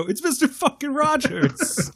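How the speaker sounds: high, slow awed exclamation; starstruck New Yorker. Retold or imitated. Tough New Yorkers spotting Mister Rogers, high slow awe. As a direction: draw it out in disbelief. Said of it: "the type of people who look at Mister Rogers and go".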